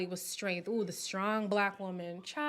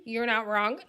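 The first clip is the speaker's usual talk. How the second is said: ordinary talk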